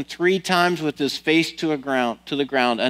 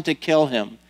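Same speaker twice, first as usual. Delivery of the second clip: normal talking voice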